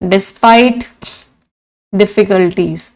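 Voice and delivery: normal talking voice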